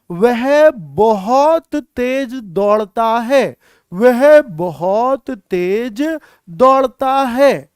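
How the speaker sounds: stretched words, very slowly; slow dictation. Shown as a demonstration. Teacher writing a longer example, slow drawn-out dictation. Writes out new example, repeating it.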